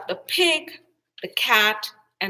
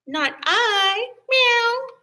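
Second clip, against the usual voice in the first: high cat voice ending in a meow; 13 semitones higher, 5 dB louder, much slower than usual. A narrator's reading. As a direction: mew it like a cat. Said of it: "And the cat said".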